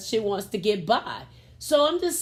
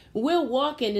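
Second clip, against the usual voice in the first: usual speaking voice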